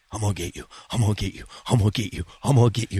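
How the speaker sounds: low, soft repeated threat; menacing chaser. Retold or imitated. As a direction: chant it low, like a pursuer right behind. Imitates runner chasing behind him.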